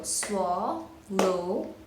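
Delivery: swallowing slowly in three clapped beats, swal, lo, ing; syllable by syllable. Shown as a demonstration. Teacher showing the cookie clap, syllable by syllable. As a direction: break swallowing apart with gaps. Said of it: "the clap goes like this".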